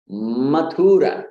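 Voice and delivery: slow Mathura, stretched U in the middle; mispronounced Mathura. Shown as a demonstration. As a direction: say Mathura as ma-THOOO-ra. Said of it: "devotees say Mathura very often".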